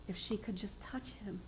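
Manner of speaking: hushed narration; whispered. A narrator's reading. Preacher retelling the woman's hope, whispered. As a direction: drop to a secret whisper.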